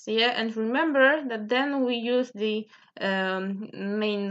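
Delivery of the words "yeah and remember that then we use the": ordinary talk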